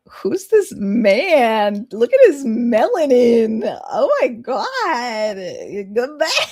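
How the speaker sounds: high, dragged-out swoon. Real, not acted. Player swooning at the handsome stranger, high and slow. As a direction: melt over him slowly.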